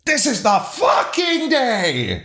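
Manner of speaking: high, slow drawn-out scream. Acted. Reader proves this is their normal state, screaming. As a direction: belt out a long high scream. Repeats the screamed line on a whim.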